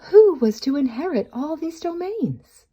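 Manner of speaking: ordinary delivery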